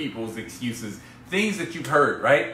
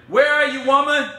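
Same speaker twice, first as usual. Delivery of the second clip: loud, higher call across the house; 9 semitones higher, 5 dB louder than usual. Real, not acted. Husband calling out for his wife on the live stream. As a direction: call out to find her.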